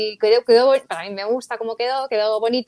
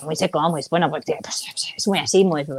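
trails into a whisper showing how dry it is; a muted sample of dry Castilian speech; dry Spanish sound; 4 semitones lower than usual. Shown as a demonstration. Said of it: "el español es como muy, pues, muy seco".

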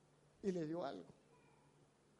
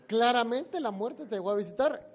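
much louder, booming declaration; loud pronouncement; 2 semitones higher, 12 dB louder than usual. Retold or imitated. Preacher retelling his words to the friend, a loud proclamation. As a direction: proclaim it like a verdict.

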